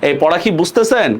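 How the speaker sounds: normal talking voice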